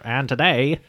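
put-on grand old ballroom announcer; old timey announcer. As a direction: boom it out like an old emcee. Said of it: "I think that's an old timey announcer".

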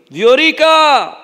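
a shepherd calling one sheep by name across the flock. Retold or imitated. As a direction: call the sheep across the field.

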